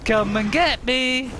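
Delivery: high, loud singsong yoo-hoo; playful high teasing call-out; taunting call. Real, not acted. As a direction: tease the dinos to chase you. Taunting dinosaurs while fleeing.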